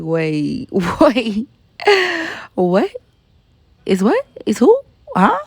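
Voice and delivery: acting out stunned confusion, short rising questions; mock-bewildered double take; baffled disbelief. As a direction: replay being dumbfounded, pausing between each question. Acts out her disbelief at suspension.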